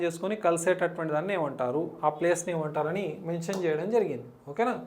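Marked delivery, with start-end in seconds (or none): none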